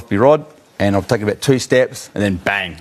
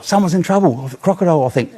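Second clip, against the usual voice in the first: imitating; 7 semitones higher than usual. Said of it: "she goes".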